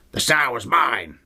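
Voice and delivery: mock the demon snarling her line. Quoting demon line from film.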